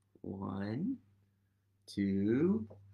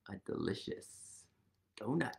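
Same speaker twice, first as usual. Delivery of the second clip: soft, breathless whisper; hushed whisper about the donut; 8 semitones lower, 10 dB quieter than usual. Acted. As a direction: whisper it like a delicious secret. Whispered treat.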